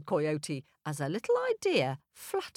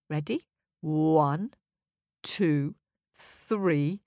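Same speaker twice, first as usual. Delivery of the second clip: ordinary delivery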